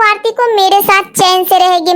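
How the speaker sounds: ordinary talk, nothing marked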